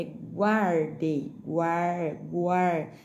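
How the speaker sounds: "guardei" very slow, stretched GWAR; sounded-out U glide. Shown as a demonstration. Teacher sounding out spelling, "guar" slow with a clear W glide. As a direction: repeat "guar" drawn out, U audible after G. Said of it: "o som do U no meio do G e do A".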